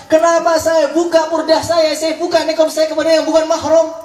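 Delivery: high-pitched wail of regret, acted; mock tearful lament. Veiled woman regretting she unveiled to a stranger, mock tearful. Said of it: "Nangis perempuannya".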